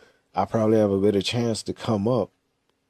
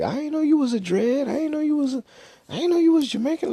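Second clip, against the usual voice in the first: squeaky high astonished voice, faster at the end; surprised friend; faster than usual. Retold or imitated. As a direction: gasp it out way up high like someone shocked to find out. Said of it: "And you'll be like".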